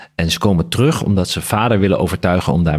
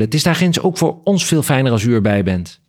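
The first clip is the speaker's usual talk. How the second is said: higher, coaxing plea; begging; 6 semitones higher than usual. A narrator's reading. Daughter-in-law begging the father to come along, pleading. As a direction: coax him along in a lifted voice. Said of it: "begon ze ineens te bedelen".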